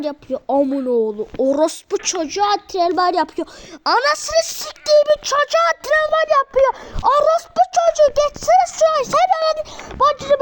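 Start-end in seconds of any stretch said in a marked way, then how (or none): 3.8-10.4 high, loud raging yell at the game; furious shout. Real, not acted. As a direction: scream in frustration at the screen.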